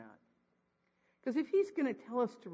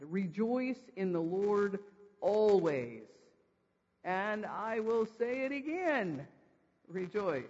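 slow, measured scriptural command; deliberate command; 3 dB louder, slower than usual. Acted. Preacher reading Paul's repeated command to the congregation, slow and deliberate. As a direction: read it out slowly, stretching the phrases. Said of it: "the repeat of this command".

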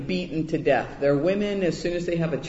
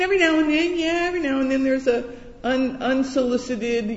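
ordinary talk, nothing marked

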